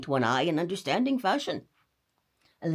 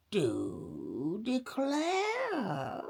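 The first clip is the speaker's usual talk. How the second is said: slow drawn-out granny murmur; murmured old lady; 2 semitones higher, 4 dB quieter, much slower than usual. A narrator's reading. Grandma murmurs amazed at the window. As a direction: linger over it like an old lady. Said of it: "murmured the old lady".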